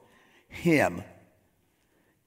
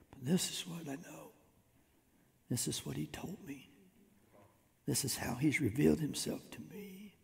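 soft, higher, confiding voice; quiet, intimate personal testimony; hushed testimony; 4 semitones higher, 8 dB quieter than usual. Acted. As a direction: drop to a near whisper as you testify. Voicing a believer's personal testimony.

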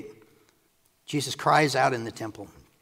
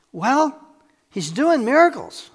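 high, loud astonished reply; acted crowd amazement, high-pitched; amazed protest; 11 semitones higher, 9 dB louder, slower than usual. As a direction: raise the voice in wonder and stretch it out.

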